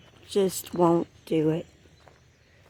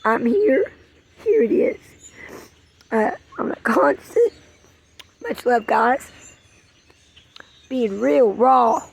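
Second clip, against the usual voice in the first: getting emotional; 5 semitones higher than usual. Real, not acted. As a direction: voice pitched up, welling with real emotion. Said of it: "I'm getting a little, um, emotional here".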